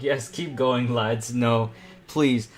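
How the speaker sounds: ordinary talk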